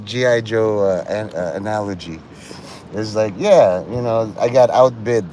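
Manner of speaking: ordinary talk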